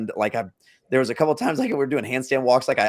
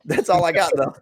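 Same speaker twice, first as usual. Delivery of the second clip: laughing; 3 semitones higher, 3 dB louder than usual. Real, not acted.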